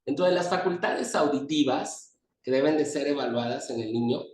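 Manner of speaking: usual speaking voice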